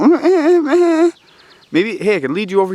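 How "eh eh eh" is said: high, loud squeaky eh eh eh; high mock squeak; imitated squeak noise. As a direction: squeal it out like the animal. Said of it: "And they go".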